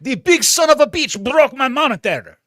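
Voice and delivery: high, loud hammy French complaint; French accent. Acted. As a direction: whine like an outraged French chef. Continues French voice.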